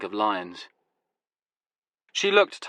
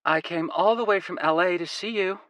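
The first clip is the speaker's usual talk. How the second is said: pleading American woman; 8 semitones higher than usual. Acted. Woman character voice.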